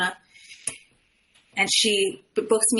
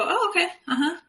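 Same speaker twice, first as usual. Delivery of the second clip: mock clueless nodding; 9 semitones higher than usual. Mocking a clueless nodding listener.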